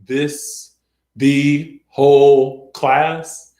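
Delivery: very slow droning teacher imitation; monotone teacher. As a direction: space out each word in a monotone. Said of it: "a teacher like that who just talked".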